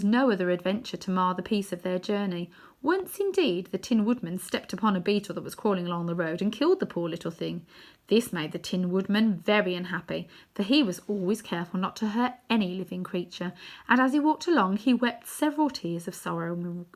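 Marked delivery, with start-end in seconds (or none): none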